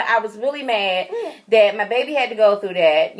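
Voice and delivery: ordinary talk, nothing marked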